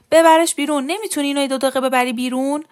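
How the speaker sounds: loud, high-pitched yell easing into a fast demand; angry shout. Acted. Husband yells down to wife with crying baby, shouting. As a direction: shout it across the house, exasperated. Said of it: "رشید سر چرخاند و داد زد".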